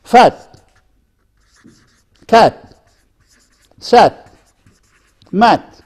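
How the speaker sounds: "Fat", "Cat", "Sat", "Mat" with a short front a; short A vowel. Shown as a demonstration. Teacher giving examples of a vowel, short a in "Fat", "Cat", "Sat", "Mat". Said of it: "What about this?".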